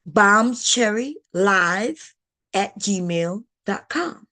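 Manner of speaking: very slow spelled-out email address; careful read-out of an email address; slow dictation. Shown as a demonstration. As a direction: stretch every syllable so they can write it down. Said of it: "Bomb Cherry Live, all one word".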